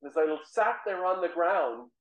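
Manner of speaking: usual speaking voice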